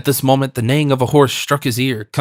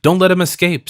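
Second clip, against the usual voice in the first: higher, raised command; loud voice; 6 semitones higher, 4 dB louder than usual. A narrator's reading. Leader orders horsemen to hold D'Artagnan, in a loud voice. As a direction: call out an order, narrator-style. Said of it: "said a loud voice".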